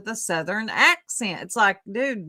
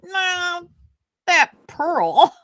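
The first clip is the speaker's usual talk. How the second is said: imitating B Tim; 9 semitones higher, 4 dB louder, much slower than usual. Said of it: "he'll be like".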